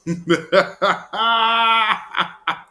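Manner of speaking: loud, high burst of laughter; hearty laugh. Real, not acted. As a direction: laugh out at your own joke. Laughs at own bit.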